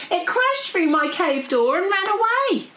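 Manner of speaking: high, dramatic dragon voice; alarmed dragon. Acted. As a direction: tell it with rising alarm. Dragon recounts the escape.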